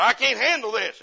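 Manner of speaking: loud, high cry of giving up; overwhelmed outburst. Retold or imitated. Street preacher quitting after a day of door knocking, loud despair. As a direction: throw up your hands in loud despair. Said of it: "He said".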